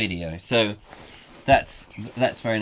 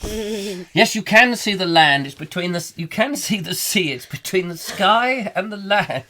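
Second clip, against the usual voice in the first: high-pitched, emphatic sarcastic explaining; exasperated hotelier; 6 semitones higher than usual. Acted. Hotelier to complaining guest, high exasperated sarcasm. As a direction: spell it out for a foolish guest, pitched up.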